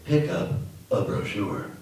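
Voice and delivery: slow, breathy near-whisper; quoted phrase. Retold or imitated. Narrator quotes the little slogan in a slow near-whisper. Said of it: "what about this little guy".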